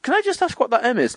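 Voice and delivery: high-pitched retold query; tentative question. Expectant dad asking the scan woman, high tentative question. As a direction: ask it lightly, up high.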